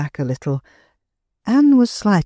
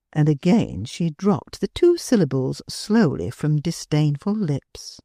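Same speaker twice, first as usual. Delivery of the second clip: plain reading voice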